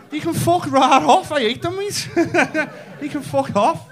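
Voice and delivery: indignant rant. Acted. Comic rants to the crowd about Dec, indignantly. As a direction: dismiss him loudly, voice pitched right up.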